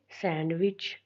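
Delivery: Sandwich as sand, short pause, विच; split into syllables. Shown as a demonstration. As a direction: divide sandwich into two clear beats. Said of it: "तो हम कैसे करेंगे".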